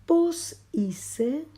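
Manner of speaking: Pos hice with Pos very high and a rising end; rising question. Shown as a demonstration. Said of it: "Un signo de pregunta". (Pos hice como pregunta, Pos muy agudo)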